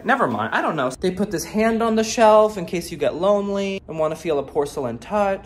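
usual speaking voice

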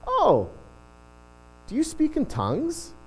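high-pitched mock Newfie questioning; light Newfie accent, high and inquisitive. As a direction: imitate a curious Newfoundlander, pitch jumping up. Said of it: "a bit of a Newfie accent".

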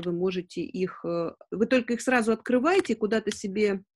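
ordinary delivery

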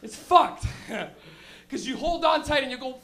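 normal talking voice

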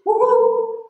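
high, loud celebratory whoop; retold whoop. Diver still has the fish, high gleeful whoop. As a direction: cheer in a sudden high burst.

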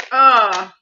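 loud disgusted cry of revulsion; disgusted yell. Real, not acted. Reacts to a gross bean.

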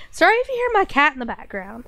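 ordinary delivery

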